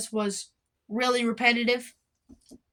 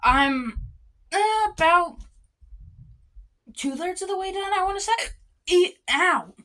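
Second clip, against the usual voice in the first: hiccups; 8 semitones higher than usual. Real, not acted. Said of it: "had the hiccups".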